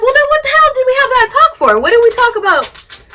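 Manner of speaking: high, loud retold protest; acted exasperated yell at a partner; angry outburst. Wife confronting partner over bringing mom along, angry outburst.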